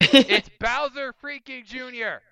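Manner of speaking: high, drawn-out outraged shout; exasperated outburst. Real, not acted. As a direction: stretch out each word in loud indignation. Repeats the outraged correction.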